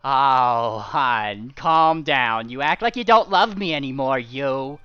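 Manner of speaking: wife dragon calming her hubby; sweet condescending wife. Acted. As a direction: talk him down like a smug spouse. Dragon wife soothing husband.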